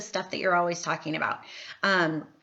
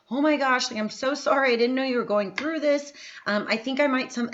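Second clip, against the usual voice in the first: gushing sympathy; 6 semitones higher than usual. Shown as a demonstration. Sample comment on someone's post.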